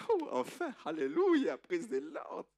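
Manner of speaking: hammed-up joyful praise; jubilant cry. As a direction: Jacob celebrates his wedding at last. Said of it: "Jacob lui dit".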